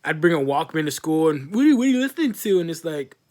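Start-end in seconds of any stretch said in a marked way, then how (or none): 1.5-2.6 put-on high, fast, louder nosy kid; mocking classmate. Classmate pesters him about his Walkman, fast high mock. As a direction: rattle it off like a nosy brat.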